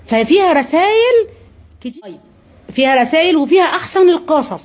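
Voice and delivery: usual speaking voice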